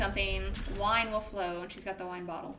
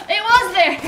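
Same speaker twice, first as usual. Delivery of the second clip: loud, high yell into a laugh; startled yell; 11 semitones higher, 15 dB louder than usual. Real, not acted. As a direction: yell in fright, then laugh. Startled by enemy then laughing.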